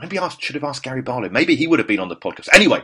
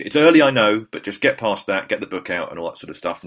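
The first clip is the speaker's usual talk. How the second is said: normal talking voice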